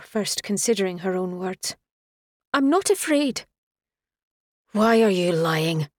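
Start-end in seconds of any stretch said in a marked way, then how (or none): 2.5-3.5 high, loud protest; defensive denial. A narrator's reading. Woman denying her fear to him, defensive and raised. 4.7-5.9 loud, irritated challenge. A narrator's reading. Man confronting her over a lie, irritated. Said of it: "his voice was laced with irritation".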